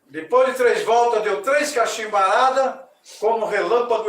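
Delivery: loud, ceremonious storytelling voice; story narration. A narrator's reading. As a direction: proclaim the myth to the audience. (narração solene e alta)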